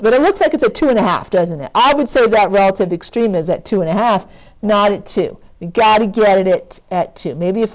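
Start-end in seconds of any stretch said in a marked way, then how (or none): none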